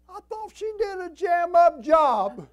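very high, loud, stretched-out protest; indignant shout. Retold or imitated. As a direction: holler it in comic disbelief. Mock indignation at mother's remark.

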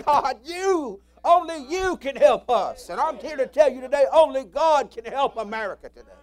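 fervent sermon climax; preaching at full force. Real, not acted. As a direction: proclaim it to the whole church.

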